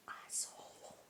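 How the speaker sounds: barely audible whisper. Real, not acted. Vlogger hesitating over a swear word to viewers, whispered. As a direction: whisper the swear word under your breath.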